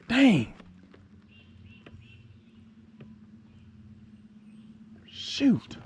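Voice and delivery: high, sharp curse; real disappointment; frustrated exclamation. Real, not acted. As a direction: spit it out in frustration. Just lost the fish.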